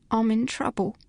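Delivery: a mild acted whimper, small and pleading. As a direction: plead like a frightened girl, understated. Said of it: "Phryne repeated her whimper".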